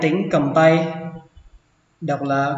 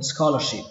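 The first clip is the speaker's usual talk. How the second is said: "scholarship" dropping in pitch on the last syllable; "scholarship" with a lowered final sound; falling final syllable. Shown as a demonstration. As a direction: let the end of "scholarship" fall. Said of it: "Xuống giọng âm cuối cùng".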